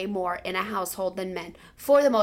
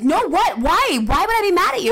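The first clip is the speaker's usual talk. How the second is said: higher, louder baffled "why"; retold baffled exclaim; 8 semitones higher, 7 dB louder than usual. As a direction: protest in disbelief. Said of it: "I'm like".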